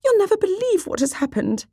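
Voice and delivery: very high, breathless-to-tell voice; high-pitched flustered excitement; excited mother. Acted. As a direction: burst with gossip, pitched right up. Excited mother bursting with news.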